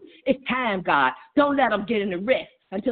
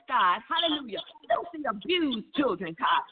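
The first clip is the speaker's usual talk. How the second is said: ordinary talk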